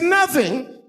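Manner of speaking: high, squeaky echo; very high incredulous question; mock astonishment. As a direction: feign shocked innocence. Feigned shock at empty box.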